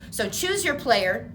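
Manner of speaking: loud game-show announcer. Acted.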